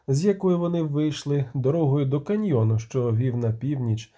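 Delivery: usual speaking voice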